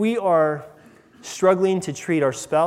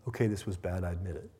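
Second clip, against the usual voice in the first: casual admission; 8 semitones lower, 11 dB quieter, faster than usual. Pretend. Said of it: "Nothing casual about that repentance".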